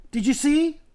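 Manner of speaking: high, eager shout; thrilled question shouted back; excited cry. Acted. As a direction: call out breathless with news. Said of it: "cried Edmund".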